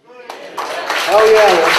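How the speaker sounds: cheering for FWA. Real, not acted. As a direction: whoop it like a fan.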